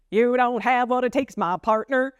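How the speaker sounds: hammed-up silly character. Shown as a demonstration. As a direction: play it as a silly cowpoke. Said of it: "of silly voices".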